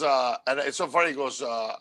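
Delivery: ordinary talk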